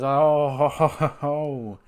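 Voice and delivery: put-on, drawn-out taunting chuckle; mocking stage laugh. [name] gloats at the feuding family, mock laughing. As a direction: chuckle as if enjoying the trouble.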